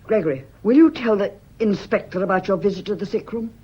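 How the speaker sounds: loud, cold demand; loud challenge. Acted. Wife dares husband to face the inspector, loud and cold. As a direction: dare him to prove it.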